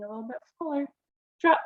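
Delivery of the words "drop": ordinary delivery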